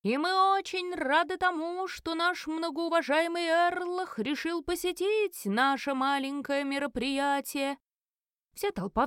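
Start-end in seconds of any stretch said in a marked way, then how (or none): 0.0-7.8 high, slow, drawn-out showman's announcement; elf auctioneer. A narrator's reading. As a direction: call out the lot like a ringmaster. Auctioneer welcomes honoured guest to crowd.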